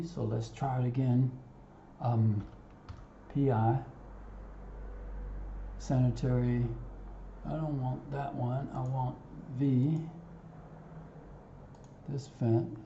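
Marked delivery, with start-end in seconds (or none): none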